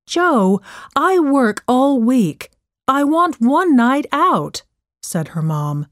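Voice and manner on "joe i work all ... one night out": higher, insistent arguing voice; exasperated mother. Acted. Working mom wants a night out, mildly exasperated. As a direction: argue your case, mildly exasperated. Acted quarrel, mother answers her daughter.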